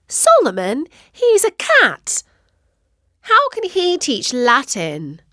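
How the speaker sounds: can't believe it's a cat; disbelief. Acted. Katie incredulous at mum's plan for the cat.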